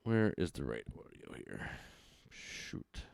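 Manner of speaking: hushed off-mic whisper. Real, not acted. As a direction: whisper to yourself while fumbling. Off-mic fumbling for audio.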